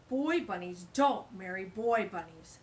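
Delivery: loud pompous bug voice; bossy stink bug. A narrator's reading. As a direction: lay down the law. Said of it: "said the stink bug".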